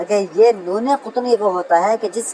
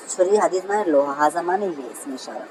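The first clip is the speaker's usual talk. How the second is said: usual speaking voice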